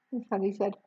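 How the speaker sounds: ordinary delivery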